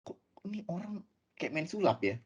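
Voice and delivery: hushed amazed whisper; breathy astonished aside. Retold or imitated. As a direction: whisper it as if to yourself. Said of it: "Saya bilang".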